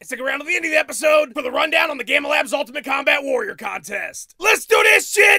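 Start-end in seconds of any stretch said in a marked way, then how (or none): 4.4-5.4 slow, loud, high rallying yell; hype shout. Acted. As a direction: fire up the crowd. Host hypes show start.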